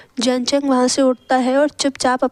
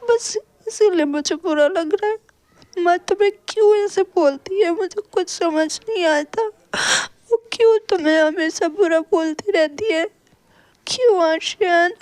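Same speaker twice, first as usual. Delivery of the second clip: much higher tearful voice breaking into sobs; crying; 6 semitones higher than usual. A narrator's reading. Sister weeps over her mother's cruelty, crying. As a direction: cry out the question, hurt and lost. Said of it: "Yanli ऐसे ही बस रोए जा रही थी".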